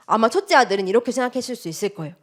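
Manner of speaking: usual speaking voice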